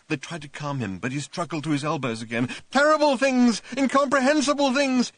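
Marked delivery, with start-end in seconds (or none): 2.7-5.1 high loud shouting. A narrator's reading. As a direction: shout in horror. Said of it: "he shouted".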